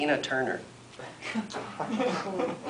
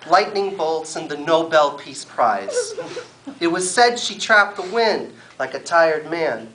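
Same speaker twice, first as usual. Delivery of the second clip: a lively deadpan poem reading, pitched up; poetry performance; 7 semitones higher, 5 dB louder than usual. Acted. Poet reading a comic poem to a live audience, deadpan. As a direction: recite it like a straight-faced slam poet.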